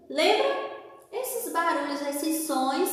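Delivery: normal talking voice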